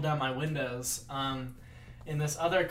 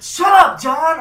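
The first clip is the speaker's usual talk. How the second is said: high, loud telling-off; mock an exasperated friend yelling; imitated scolding shout; 12 semitones higher, 16 dB louder, slower than usual. Girls scold their friend in story.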